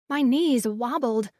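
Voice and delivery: ordinary delivery, nothing marked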